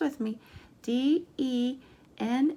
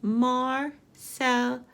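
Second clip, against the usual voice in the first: Marcelo slowly in three separate beats; slow, separated Marcelo for counting; syllable by syllable; much slower than usual. Shown as a demonstration. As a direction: pause between each syllable of Marcelo. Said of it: "Let's count the syllables".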